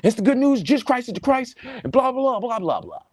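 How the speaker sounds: lampoon a hollow preacher rattling off lines; mock gospel pitch. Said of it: "while I'm defending the gospel".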